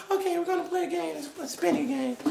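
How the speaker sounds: usual speaking voice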